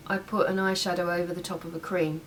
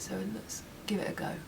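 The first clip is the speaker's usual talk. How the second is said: whispering; 8 dB quieter than usual. Real, not acted. Whispered aside while applying.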